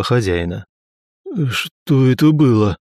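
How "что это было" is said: slow pained groan. A narrator's reading. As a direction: moan it out, dazed. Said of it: "простонал княжич". (стон)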